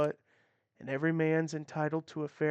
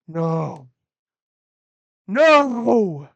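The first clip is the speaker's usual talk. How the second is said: a rising desperate No into a full yell; anguished shout; 2 semitones higher, 14 dB louder than usual. Acted. Husband shouting as his family is attacked, desperate yell. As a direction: cry out in horror. Horrified cry as wife is attacked.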